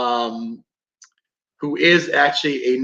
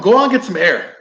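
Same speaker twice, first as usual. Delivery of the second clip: higher, louder irritated command; retold pissed-off order; 5 semitones higher, 5 dB louder than usual. Investigator ordering his entranced teammate out, pissed off. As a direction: replay ordering someone outside, fed up. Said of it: "I was pissed, getting pissed off".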